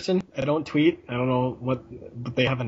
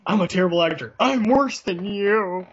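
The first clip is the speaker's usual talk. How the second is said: lightly hammed-up bad actor, pitched higher and louder; mock bad actor; 6 semitones higher, 5 dB louder than usual. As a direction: play a wooden movie actor, a touch overdone. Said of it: "Can you imagine what it would be like".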